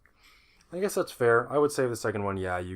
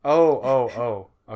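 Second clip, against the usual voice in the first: usual speaking voice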